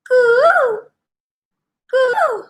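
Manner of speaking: high sung coo like a bird; cuckoo call. A narrator's reading. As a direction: sing out a sweet bird coo. Cuckoo singing heard by bee.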